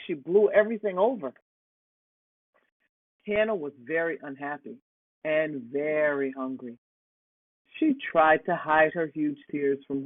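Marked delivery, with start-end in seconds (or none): none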